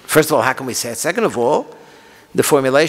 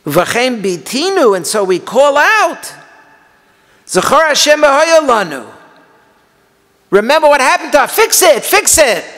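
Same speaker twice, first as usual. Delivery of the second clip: impassioned lament; 11 semitones higher, 6 dB louder than usual. Acted. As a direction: plead against God at full force. Calling out to God.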